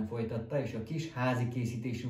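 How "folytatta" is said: ordinary delivery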